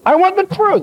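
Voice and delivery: high-pitched acted insistence; mock indignation, pitched well up; insistent demand. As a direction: argue back like an exasperated king. Acted king insisting in quarrel.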